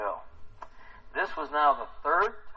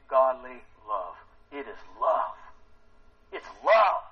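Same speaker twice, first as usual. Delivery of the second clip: ordinary talk, nothing marked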